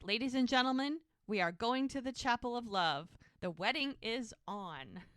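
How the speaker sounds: imitated master of ceremonies; mock announcer. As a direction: announce it grandly to a whole crowd, playfully. Retold announcement to barn crowd.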